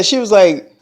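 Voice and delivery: ordinary delivery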